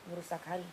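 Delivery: hari said softly and low, checking the word; quiet repeat. Shown as a demonstration. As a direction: murmur Ngerusak hari to yourself. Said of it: "Hari lidah maksudnya?".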